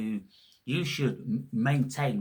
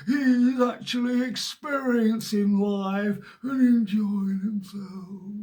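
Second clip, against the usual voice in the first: much higher, slow, louder sneering busybody voice; hammed-up tutting herd member; mock rule-follower; 13 semitones higher, 8 dB louder, slower than usual. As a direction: drag it out like a disapproving prude. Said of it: "they've got something to say about that".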